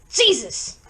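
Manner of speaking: sudden shouted exclamation; startled yell. A narrator's reading. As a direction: yell out as if jumping in fright. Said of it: "Garcia yelled out, jumping".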